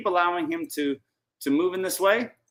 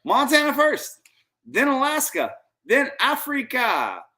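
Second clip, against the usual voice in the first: excited; 7 semitones higher than usual. Real, not acted. Hyped trip announcement.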